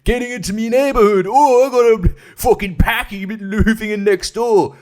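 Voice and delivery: high, loud put-on British yob rant; mock outraged chavvy British accent; Tommy Robinson. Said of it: "I keep wanting to say Tommy Robinson".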